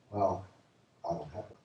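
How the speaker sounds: low, hushed admission; sheepish reply. Retold or imitated. Preacher as woman admitting it to Jesus, low sheepish voice. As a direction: drop the voice for a reluctant confession.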